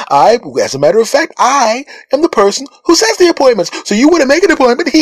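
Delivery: overly cheerful, parroting the caller; perky receptionist. Retold or imitated. Dental receptionist repeating the caller's request, perky and high. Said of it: "bright and sunny".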